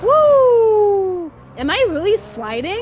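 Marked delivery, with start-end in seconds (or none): 0.0-1.3 loud high woo; sudden loud cheer; excited whoop. Real, not acted. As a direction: let out a thrilled whoop. Sliding down hill in car.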